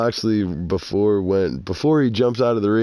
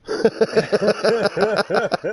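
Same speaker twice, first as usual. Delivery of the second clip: laughing; 12 semitones higher than usual. Real, not acted. Laughs at retold insult.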